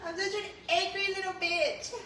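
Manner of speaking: mock the comment back in a high voice. Repeating a viewer's jab back, high and mocking.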